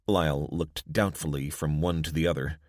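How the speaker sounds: ordinary delivery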